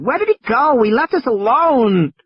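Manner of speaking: Lex panics that Ed Regis abandoned them; frightened child. Acted. High, frightened child's voice. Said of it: "Lex said".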